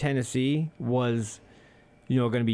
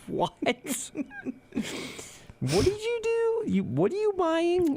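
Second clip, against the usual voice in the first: much higher, slow baffled squeal; shocked what; slower than usual. Retold or imitated. Customer stunned by the girl's words, high baffled squeal. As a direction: squeak in total disbelief.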